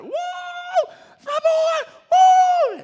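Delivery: loud high whooping shout; joyful hollering. Retold or imitated. New dad hollering in the delivery room. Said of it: "I just start, like, hollering".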